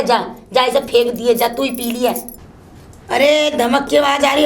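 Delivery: normal talking voice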